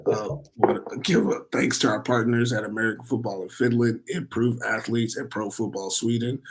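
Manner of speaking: ordinary talk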